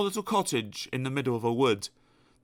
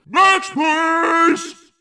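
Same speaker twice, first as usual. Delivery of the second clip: much higher, very loud call; shouting next; 8 dB louder than usual. Acted. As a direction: dismiss him with a yell. Grocer calls next customer.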